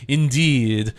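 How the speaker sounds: hammed-up villainous French accent. Latour dismissing the escaped worker in a villain French accent. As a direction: play a scheming French villain, a bit over the top. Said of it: "getting into more villain French".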